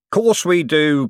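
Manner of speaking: booming matey yes; hearty agreement. A narrator's reading. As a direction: slap the idea on the back. Harry King agrees.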